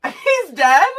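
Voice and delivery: high, loud laughing disbelief; laughing shock. Real, not acted. Cohost learns Bob Ross is dead, laughing in shock. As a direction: squeal it out through a laugh.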